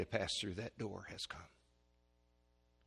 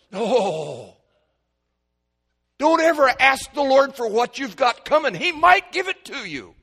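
climbing high, loud warning cry; preaching shout; 13 semitones higher, 14 dB louder than usual. Real, not acted. Preacher shouting a warning to his congregation, loud and high. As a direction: thunder the warning at them.

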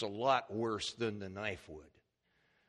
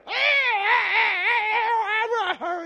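long, very loud high wail; imitated bawling; 15 dB louder, much slower than usual. Father imitating his injured son, bawling and crying. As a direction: wail like a hurt little boy, drawn out. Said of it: "He's bawling and crying".

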